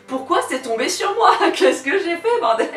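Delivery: high, laughing, breaking voice; voice cracking. Real, not acted. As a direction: voice giving out with laughter. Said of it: "j'ai la voix qui repart un petit peu en live".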